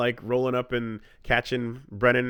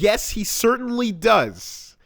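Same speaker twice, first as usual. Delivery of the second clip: slow, higher, heroic anime hero voice; anime protagonist voice; 9 semitones higher, 4 dB louder, slower than usual. Pretend. Answering his friend's tease in a slow, higher anime hero voice. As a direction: puff up like a second-rate anime hero. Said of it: "like a tier two anime protagonist".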